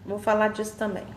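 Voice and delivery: usual speaking voice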